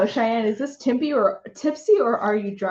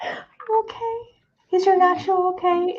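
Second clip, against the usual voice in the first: mock baby voice; 11 semitones higher than usual. Mock concern for nacho.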